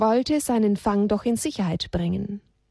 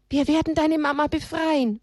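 comforting promise; 6 semitones higher than usual. Acted. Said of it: "versprach ihm Elia".

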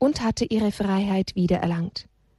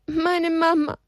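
put-on tearful child; 7 semitones higher than usual. Crying child explains.

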